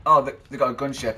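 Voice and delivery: loud, startled exclamation; startled outburst. Real, not acted. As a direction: blurt it out alarmed. Noticing enemy gunship.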